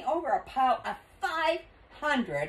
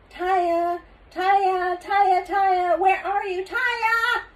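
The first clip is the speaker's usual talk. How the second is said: high, raised searching call; 11 semitones higher, 5 dB louder, much slower than usual. A narrator's reading. Father searches the store for his daughter, calling out. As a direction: call the name over and over, high.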